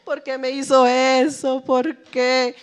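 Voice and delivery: slow, mock tearful lament; imitating crying alone. Preacher imitating someone crying alone in their room, mock tearful. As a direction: act out weeping alone at night, asking why. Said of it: "llorando como que".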